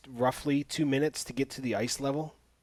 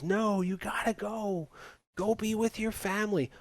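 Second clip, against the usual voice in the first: hammed-up movie goodbye in a raised voice; mock tearful farewell; 8 semitones higher, 3 dB quieter than usual. The kid sending Jack back to his family, mock heartfelt plea.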